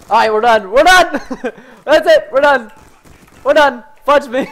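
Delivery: loud, high, laughing exasperation; exasperated shouting. Real, not acted. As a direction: yell that it's over, half laughing. Giving up, laughing, overwhelmed.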